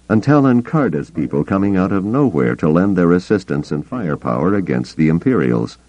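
ordinary delivery

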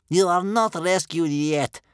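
high, loud, slow grandmother rebuke; stern. A narrator's reading. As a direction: shut down the hope sternly. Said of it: "said his grandmother sternly".

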